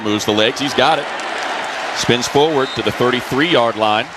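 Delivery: ordinary talk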